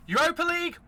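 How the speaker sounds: high, incredulous squeak on Europa League; squeaked, disbelieving question; mock disbelief. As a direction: scoff at the very idea of Europa League. Mocking the lesser competition.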